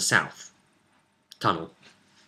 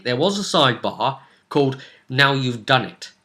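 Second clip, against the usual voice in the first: ordinary talk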